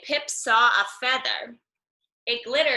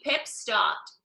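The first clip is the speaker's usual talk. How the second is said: ordinary delivery, nothing marked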